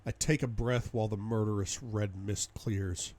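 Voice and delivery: usual speaking voice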